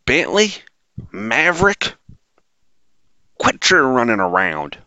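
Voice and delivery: put-on high-pitched southern twang calling her kids; southern accent. Mother scolding her running kids, in a southern accent. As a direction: mimic a southern mom yelling at her kids, loud and high. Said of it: "I'm gonna give her a southern accent here".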